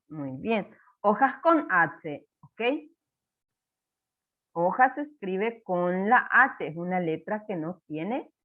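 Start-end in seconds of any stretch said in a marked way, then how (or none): 1.0-6.7 slow and clear, stretching the words; teacher explaining the silent H; slow spelling explanation. Shown as a demonstration. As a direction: spell it out slowly for a child. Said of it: "teaching spelling slowly".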